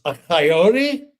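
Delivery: loud, high startled question; surprised drunk. Retold or imitated. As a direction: blurt it out in disbelief. Drunk hears dog is coyote.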